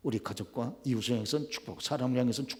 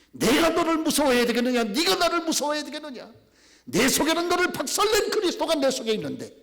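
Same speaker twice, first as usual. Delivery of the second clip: very high, loud taunting question; shouted challenge; 12 semitones higher, 8 dB louder than usual. Retold or imitated. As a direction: dare the demon to answer. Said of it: "큰 소리로 막 대답합니다". (귀신에게 높고 크게 호통치는 목소리)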